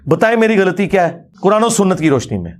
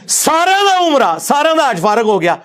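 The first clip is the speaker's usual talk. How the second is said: high, drawn-out exclamation; mock outcry; 8 semitones higher than usual. Real, not acted. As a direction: stretch it out high, in scornful disbelief. Mocking outcry over the claimed error.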